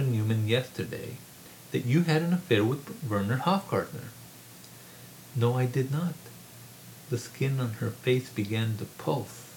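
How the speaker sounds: measured reading voice, lightly voicing the characters; story narration. A narrator's reading. Author reading his novel aloud to a room, measured narration. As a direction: read the novel to an audience.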